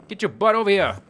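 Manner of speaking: loud, high taunting call; mock-bossy shout at a monster. Calling out to a game monster.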